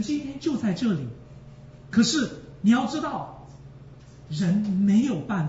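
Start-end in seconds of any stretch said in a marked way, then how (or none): none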